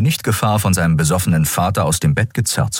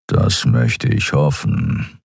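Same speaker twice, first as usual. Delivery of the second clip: slow, low beast-man rumble; big cat creature's menacing rumble; low growling grumble; 5 semitones lower, much slower than usual. A narrator's reading. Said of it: "brummte er".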